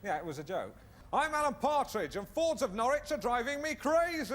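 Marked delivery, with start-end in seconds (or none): 1.1-4.3 raised-pitch American advert voice; hammy American car ad read; American accent. Pretend. Showing off an American accent with a local ad line. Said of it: "I can do American accent".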